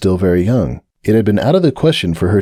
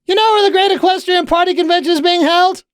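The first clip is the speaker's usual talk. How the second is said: very high, eager question; bright young pony voice, all bounce; bubbly Pinkie. Acted.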